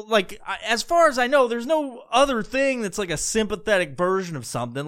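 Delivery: ordinary talk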